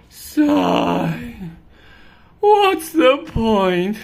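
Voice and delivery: a lightly voiced fed-up cat; bored cat.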